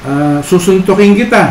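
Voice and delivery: put-on child getting angry at the echo; mock angry threat. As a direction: threaten like an annoyed kid. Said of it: "parang nagagalit siya".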